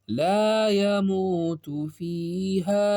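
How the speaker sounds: very slow, drawn-out chant; teacher modelling the verse slowly; slow Quranic recitation. Shown as a demonstration. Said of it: "اسی طریقے سے".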